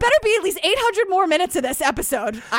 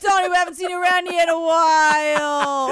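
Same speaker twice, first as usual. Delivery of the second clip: put-on Queens accent. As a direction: call out in a thick Queens accent. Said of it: "with my Queens accent".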